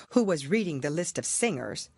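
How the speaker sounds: usual speaking voice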